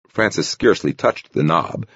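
ordinary delivery, nothing marked

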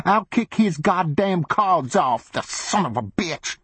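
high, slow woman's threat; a woman spitting threats, pitched high; angry woman's voice. A narrator's reading.

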